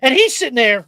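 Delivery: ordinary delivery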